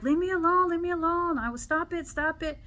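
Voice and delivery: high-pitched mock pleading; high, hurried girl's protest. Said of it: "and the girl's like".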